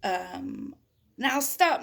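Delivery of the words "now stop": commanding stop. Acted. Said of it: "Max said".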